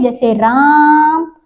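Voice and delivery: राम stretched slowly, the आ held long; drawn-out vowel. Shown as a demonstration. Teacher stretching राम to show a pluta vowel. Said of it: "वो प्लुत स्वर होते हैं".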